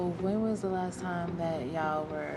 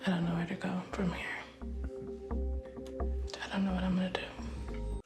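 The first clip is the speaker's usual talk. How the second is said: whispering. Real, not acted. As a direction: barely whisper it. [name]'s quiet show audio.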